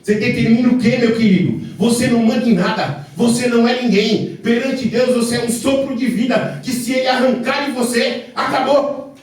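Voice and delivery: loud, high shouted sermon; fiery preaching. Acted. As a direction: thunder it from the pulpit. Preaching at full force.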